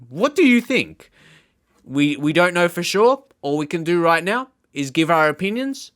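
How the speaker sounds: usual speaking voice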